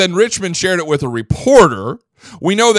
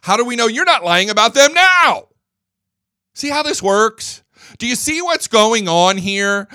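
ordinary talk, nothing marked

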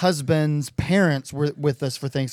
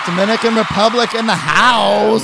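hype shout; 6 semitones higher, 6 dB louder, slower than usual. Real, not acted. Hyping the guest's entrance.

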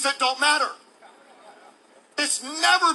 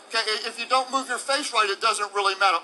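ordinary delivery

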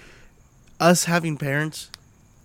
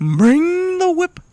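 mock teasing; 7 dB louder, much slower than usual. Joking bedroom tease.

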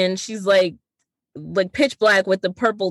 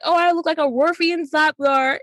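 Starfire voice; 8 semitones higher than usual. Retold or imitated. Starfire's odd alien joke.

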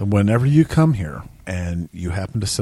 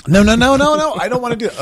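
squeaky, much higher no-no-no; high mock protest; 12 semitones higher, 5 dB louder, faster than usual. Friend teasing him about the voice, squeaky high protest.

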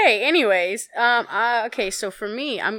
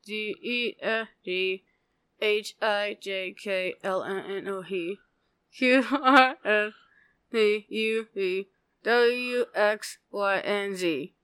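alphabet through still lips; soft ventriloquist mumble; without moving mouth; 7 dB quieter, slower than usual. Shown as a demonstration. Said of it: "sing the alphabet without moving your mouth".

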